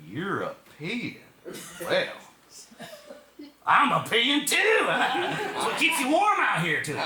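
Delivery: a hint of a dim backwoods local. Local mishears European.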